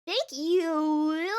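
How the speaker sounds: slow, high swooning thanks; playful exaggerated coo; hammed-up swoon. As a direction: drag out the ooh like a flattered diva.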